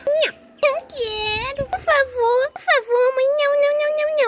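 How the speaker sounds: put-on high, begging child's whine; whining plea. Boy begs mother not to wash cut, pleading. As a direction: plead desperately to be spared.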